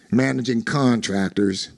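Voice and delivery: usual speaking voice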